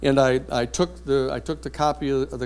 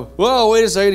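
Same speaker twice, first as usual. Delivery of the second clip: loud, high startled cry; mock alarmed judge; 7 semitones higher, 8 dB louder than usual. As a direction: wake up with a jolt and call out. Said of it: "he goes".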